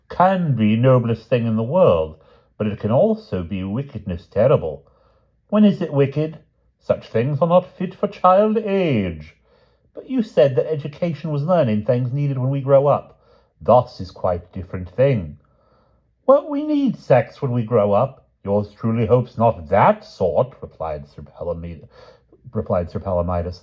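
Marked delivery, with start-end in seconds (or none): 0.1-4.8 haughtily. Acted. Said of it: "said Sir Palomides haughtily". 5.5-20.5 put-on higher, louder children and a stiff foreign tutor; pompous tutor against cheeky boys; schoolroom squabble. Palomides lectures the boys, higher and louder.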